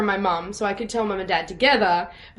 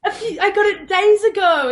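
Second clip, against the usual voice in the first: high, louder, half-laughing admission; retold confession; 10 semitones higher, 5 dB louder than usual. Daughter admitting to mum she got it days ago, laughingly blurting. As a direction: blurt it out sheepishly through a laugh.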